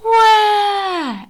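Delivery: high, loud hammed-up baby wail; cartoon sob read aloud; mock crying wail. Shown as a demonstration. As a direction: wail like an overdramatic picture-book crier. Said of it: "We have some very elaborate crying going on".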